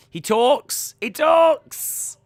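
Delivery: very high, loud squeal of delight; real excited squeal; excited exclaim. Real, not acted. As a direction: shriek it in amazed glee. Excited disbelief.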